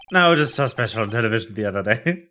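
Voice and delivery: light Texan drawl, low and easy. Acted. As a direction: say it as the cowboy officer. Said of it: "mock retake".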